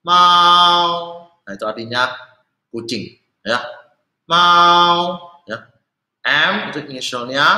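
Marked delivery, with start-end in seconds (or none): 0.0-1.2 MAU on a high, level tone; nada satu. Shown as a demonstration. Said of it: "nada satu". 4.3-5.3 MAU on a high, level tone; nada satu. Shown as a demonstration. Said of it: "nada satu".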